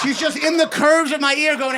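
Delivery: usual speaking voice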